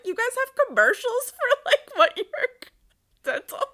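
high, loud disbelieving squeak; mock amazement at the dental commercials; incredulous. Retelling to the hygienist, high incredulous disbelief.

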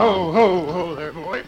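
reining in horse. Acted. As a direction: haul the horse up with a loud call.

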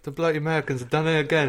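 put-on British accent. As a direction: play an outraged Brit, British accent. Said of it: "British people are like".

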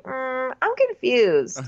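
high-pitched clueless imitation; mock bewilderment.

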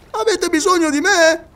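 high excited scholar calling down from a tree; Paganel's voice. Acted. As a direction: play the absent-minded geographer, pleased with himself.

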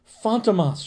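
high, falling cry of dread; terrified cry. A narrator's reading. Cornered patient pointing at the professor, crying out. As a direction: cry the name out in fear. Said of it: "she cried".

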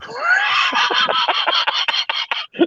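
high shrieking laugh. Real, not acted. Big laugh.

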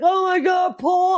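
high-pitched pleading outburst; mock distressed wail. Host as cameras stop on a loser, mock distressed wail. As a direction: wail the name in comic distress. Retold distress over losing contestant.

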